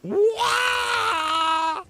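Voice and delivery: sudden high startled yelp. Real, not acted. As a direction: jump at the scare and yelp. Jumpscare in game, startled cry.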